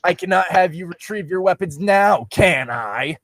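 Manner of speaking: high, mocking villain voice, lifted well up; sinister villain taunt. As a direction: taunt her like a gloating villain. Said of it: "he said in a sinister laugh".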